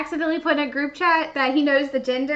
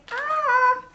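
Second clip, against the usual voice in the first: high, sung-out "ah"; real high fangirl squeal; delighted squeal; 10 semitones higher, 3 dB louder than usual. Real, not acted. Delight at a favourite movie.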